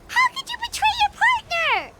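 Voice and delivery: high, indignant shout of betrayal; outraged accusation. Acted. Paimon scolding the traitor, high indignant outrage. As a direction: hurl the accusation up high, scandalized.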